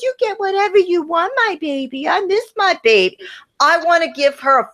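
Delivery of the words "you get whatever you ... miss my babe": higher, cooing grandma voice; doting grandma voice. Retold or imitated. Host mocks the grandma spoiling the kid, cooing high. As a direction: coo at her like a doting grandma. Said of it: "and goes".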